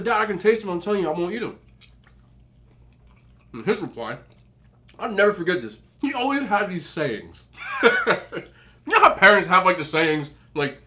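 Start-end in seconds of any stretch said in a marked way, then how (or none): 0.0-1.6 put-on insistent kid. Child insists to his dad. 7.5-8.5 loud high burst of laughter; big laugh. Real, not acted. Laughs at dad's saying.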